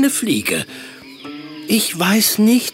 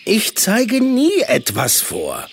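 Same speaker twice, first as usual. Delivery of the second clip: growling tiger; 9 semitones higher than usual. A narrator's reading. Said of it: "knurrte Mula".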